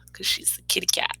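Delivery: breathy whisper, leaning in; whispered aside. Real, not acted. Reader explains the pun to children in a whisper. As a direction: share the joke in a whisper. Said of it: "aside to listener".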